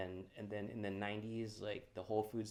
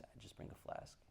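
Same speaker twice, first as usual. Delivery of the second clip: whispered like a secret; sneaking a flask into comedy shows, whispered; whispered aside; 12 dB quieter than usual. Real, not acted. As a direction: let them in on it quietly. Joking confession about a flask.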